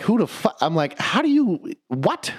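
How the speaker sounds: high, baffled outburst; stunned. Retold or imitated. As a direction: splutter in disbelief.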